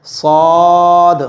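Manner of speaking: "सौद" held very long with full rounded vowel; pronunciation demo. Shown as a demonstration. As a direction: stretch out "सौद" slowly on a level tone. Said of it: "side के दांतों से लगेगा". ("सौद" को खींचकर मोटा पढ़ें)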